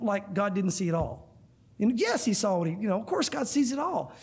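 normal talking voice

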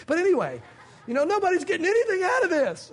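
laughing through the words. Real, not acted. Preacher laughing at his own joke, laughing. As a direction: chuckle at himself. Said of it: "laughing at himself".

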